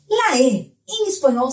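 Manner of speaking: normal talking voice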